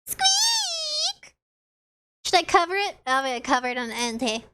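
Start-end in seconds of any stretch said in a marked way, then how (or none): 0.1-1.3 much higher pained mouse squeal; high crying squeak; dying squeak. Acted. Mouse being eaten.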